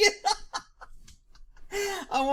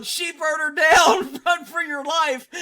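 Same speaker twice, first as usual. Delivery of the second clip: retold yell, slower, louder and higher; mock yell; 6 semitones higher, 5 dB louder, slower than usual. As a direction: shout it like a panicked herder. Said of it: "This dude's yelling".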